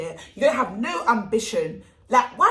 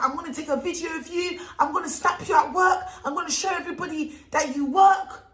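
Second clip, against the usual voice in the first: mock threat shout; 6 semitones higher than usual. The girl threatening to film him at work, mock yelled. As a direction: shout her threat back, hammed-up. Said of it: "she's like".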